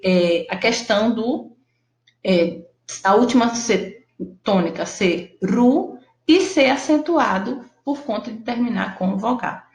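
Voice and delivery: normal talking voice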